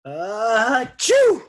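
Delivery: high, explosive achoo; huge sneeze. A narrator's reading. Teacher with pepper in his face, explosive sneeze. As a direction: build up and blast out a sneeze.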